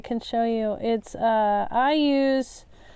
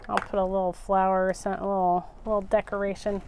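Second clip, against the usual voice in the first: rattled off quickly, a little lower; quick and offhand; 4 semitones lower, 4 dB quieter, faster than usual. Real, not acted. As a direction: brush it off fast, half to yourself. Fixing a mistake, quick plan.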